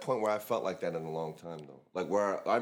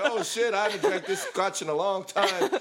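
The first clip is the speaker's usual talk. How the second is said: laughing; 8 semitones higher, slower than usual. Retold or imitated. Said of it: "You're like".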